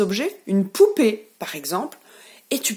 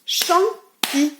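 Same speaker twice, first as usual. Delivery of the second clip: champignon slowly split into beats, cham-pi-gnon, higher; clapped syllables; 6 semitones higher, much slower than usual. Shown as a demonstration. Teacher claps syllables with the children, slow and high. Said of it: "On le fait ensemble?".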